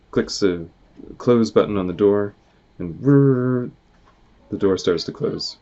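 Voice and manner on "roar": growling roar; bear roar. Acted. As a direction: roar like a beast. Narrator voices bear.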